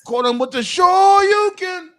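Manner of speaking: high, loud hype callout; excited shout. Real, not acted. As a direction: yell the move name like a fighting game. Hyped callout of a move.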